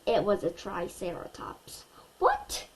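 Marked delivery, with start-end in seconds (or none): 2.2-2.7 very high startled what; a mother's sharp shocked what; shocked mom's cry; surprised cry. A narrator's reading. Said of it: "cried my mom".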